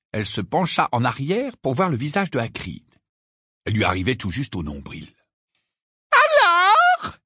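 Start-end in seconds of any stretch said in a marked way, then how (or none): 6.1-7.2 piercingly high, drawn-out shout; slow and very loud. A narrator's reading. Umbridge addresses Hagrid, slow and very loud. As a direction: speak as if to a deaf person. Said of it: "en parlant très fort comme si elle s'adressait à un sourd".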